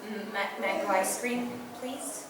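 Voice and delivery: timid, stammering order in a small voice; uncomfortable. Acted. Girl ordering ice cream while stared at, uncomfortable. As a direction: stammer it softly, trailing away.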